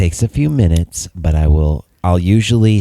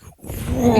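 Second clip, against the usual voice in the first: startled whoa; 12 semitones higher, 5 dB quieter than usual. Retold or imitated. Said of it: "like, I'm like".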